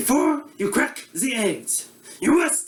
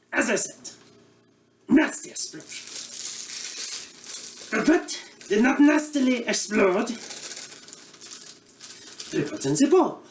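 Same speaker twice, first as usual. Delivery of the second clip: put-on comic Eastern European accent as a bumbling TV cook; broad Russian accent; mock Russian accent; 2 semitones higher than usual. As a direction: play a hammy Russian-accented chef host. Comedic Boris cooking show character.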